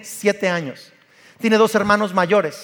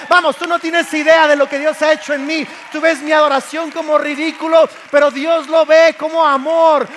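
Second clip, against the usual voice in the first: David's rebuke, climbing from firm to a higher, louder shout; building to a shout; 5 semitones higher, 4 dB louder than usual. Acted. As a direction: pour it out louder and higher until it's a cry. Preacher voices David answering Mical. (David le grita a Mical, cada vez más fuerte y agudo)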